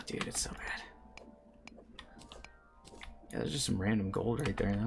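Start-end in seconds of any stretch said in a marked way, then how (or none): 0.1-0.8 whispering. Real, not acted. Complaining about lag.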